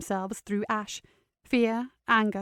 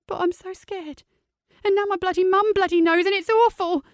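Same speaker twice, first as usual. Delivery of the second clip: put-on high, loud, distressed teen; upset, near tears; 11 semitones higher, 6 dB louder than usual. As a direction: voice on the edge of sobbing.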